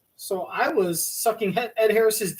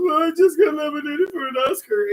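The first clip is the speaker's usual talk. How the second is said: high, loud mock-weepy voice; hammed-up tearful announcement; mock crying; 8 semitones higher, 5 dB louder than usual. Host imitates an actress crying to a waitress, mock weeping. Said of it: "and you're crying".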